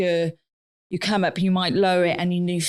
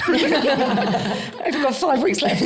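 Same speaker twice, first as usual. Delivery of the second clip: laughing dismay; 6 semitones higher, much slower than usual. Real, not acted. Laughing about the pod workload.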